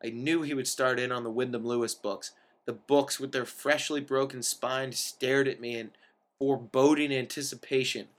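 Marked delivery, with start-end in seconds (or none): none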